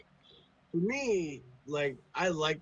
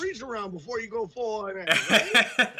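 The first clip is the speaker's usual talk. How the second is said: mock newcomer protest; 5 semitones higher, 6 dB louder than usual. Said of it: "and you're like".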